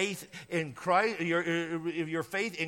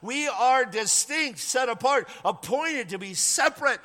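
emphatic preaching; 6 semitones higher, 4 dB louder than usual. Real, not acted.

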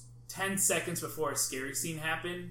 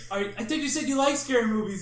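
shouted, higher, quick teasing call-out; mock shouted accusation; 8 semitones higher, 7 dB louder, faster than usual. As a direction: yell it at a friend who lied. Friends calling out Ian.

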